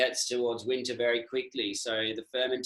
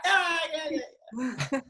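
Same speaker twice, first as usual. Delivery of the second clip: high, giggly mock yes, much higher; mock eager yes; much slower than usual. Anyone texting Daniela gets a high, laughing mock yes. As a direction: squeal a carefree yes through a laugh. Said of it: "anyone in the world texted should just be like".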